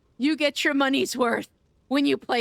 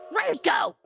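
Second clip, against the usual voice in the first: higher-pitched cry of the name; shocked shout; 4 semitones higher than usual. Real, not acted. Streamer to a generous gifter, shocked shout. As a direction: yell out in disbelief at the gift. Exclaims at another big gift.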